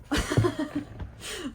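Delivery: high, giddy laugh; a delighted little laugh. Real, not acted. Delighted by packaging.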